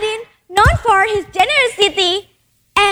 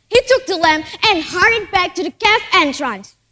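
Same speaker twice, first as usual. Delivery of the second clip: plain reading voice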